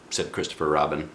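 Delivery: ordinary delivery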